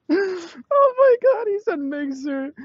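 very high, drawn-out laughing wail; real breathless hilarity; cracking up. Real, not acted. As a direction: squeal it slowly through helpless laughter. Laughing uncontrollably at Mixer answer.

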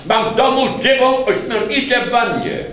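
loud high breathless news; boy bursting into his friend's flat; excited shout. A narrator's reading. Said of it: "krzyknął".

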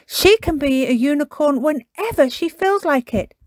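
put-on high, loud child character; Peppa, persuasive. A narrator's reading. Peppa persuades Susie with magic.

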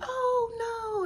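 high squeaky oh no, brushing it off; mock dismay. To coworkers after dropping her drink, a high mock oh no. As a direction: play off the spill with a high little oh no. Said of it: "I played it off like".

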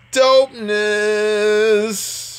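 high, very slow and stretched out; sing-song show-off drawl; drawn-out dopeness. Real, not acted. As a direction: savour the word like a flex. Savouring his upload boost.

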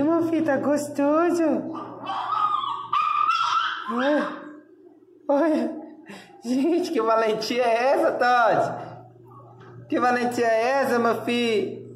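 high singsong cooing at a brave dog. Real, not acted. Owner teasing her growling dog in high baby talk.

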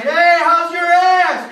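high, drawn-out shout; jeering call. Retold or imitated. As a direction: holler it slowly from a passing car. Jeering passers-by shout at him.

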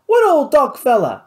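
loud, high angry outburst; exploding. A narrator's reading. Louis questioning Marcel about the doc, exploding. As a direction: blow up at the news. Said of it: "Louis exploded".

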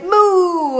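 high, drawn-out moo; cow's moo. A narrator's reading. Cow celebrates the thieves' capture, mooing high. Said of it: "Then the cow said".

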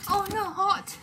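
big gasp, then high worried voice; playful storybook fear; pretend fright. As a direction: gasp and squeak in mock alarm. Reacting to dragon's roar.

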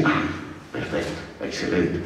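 a hushed, satisfied "perfecto, excelente"; mock relieved parent. Teacher mocking a parent content with the grades, hushed. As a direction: breathe it out like a parent who heard what they wanted.

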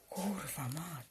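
bezgłośne, cichsze przekleństwo pod nosem; szept. A narrator's reading. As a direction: wyszepcz to przeciągle, samym oddechem. Przekleństwo postaci po słowach z telefonu. (przekleństwo wypowiedziane szeptem)

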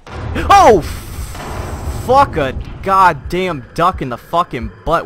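sudden frightened yelp into cursing; jump-scare shriek, then swearing; startled scream. Real, not acted.

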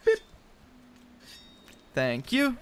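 ordinary delivery

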